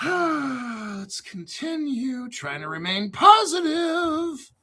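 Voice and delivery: high, slow, drawn-out sigh of forced cheer; strained positivity. Real, not acted. Host resetting after a rant to stay positive, strained cheer.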